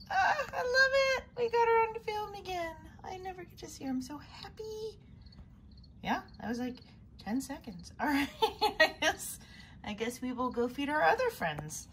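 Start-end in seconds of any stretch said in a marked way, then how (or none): 0.1-5.0 high, loud happy squeal; thrilled. Real, not acted. Rare spider finally eats. 7.2-9.4 laughing. Real, not acted. Laughing after rare sighting.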